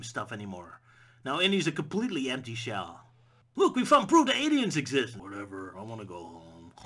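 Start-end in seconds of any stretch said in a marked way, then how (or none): 3.6-5.2 higher, louder eager announcing; mock excited. Sidekick telling Indy about aliens, excited. 5.2-6.7 much lower, softer listless mumble; bored Indy. Acted. Empty-shell Indy brushing off aliens, bored.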